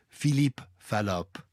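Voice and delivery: Philippe Fallope said slowly in a put-on French accent; mock French name. Host slips in a joke name as a French inventor, mock French accent. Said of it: "my favourite is another Frenchman".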